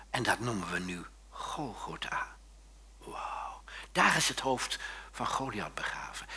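soft, half-whispered reveal; quiet wonder; hushed awe. Real, not acted. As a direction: let it sink in, almost whispering. Awed reveal of Golgotha.

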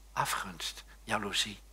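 hushed whisper; soft whispered emphasis; whispered word. Real, not acted. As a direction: breathe the word out. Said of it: "whispered key word".

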